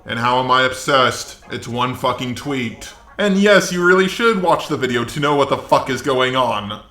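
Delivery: ordinary delivery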